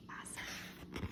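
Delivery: breathy whisper of praise. Real, not acted. Praise after asking kids to grunt.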